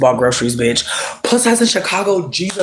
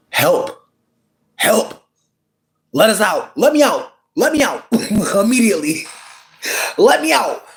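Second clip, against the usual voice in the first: mock trapped cry; 5 semitones higher than usual. Pretending to be trapped.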